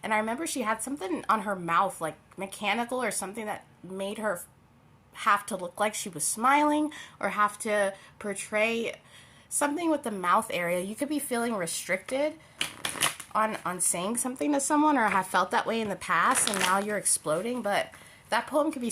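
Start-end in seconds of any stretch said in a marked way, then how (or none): none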